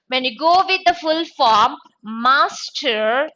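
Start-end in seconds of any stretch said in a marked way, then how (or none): none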